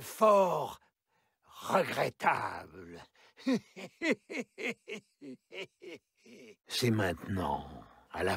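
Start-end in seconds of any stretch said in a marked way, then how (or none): 3.4-5.0 long high wicked cackle; evil cackle. Acted. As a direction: cackle with cruel delight. Villain laughs between lines.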